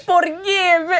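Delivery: high imitated Spanish-accented old man; broken English. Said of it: "In his broken English".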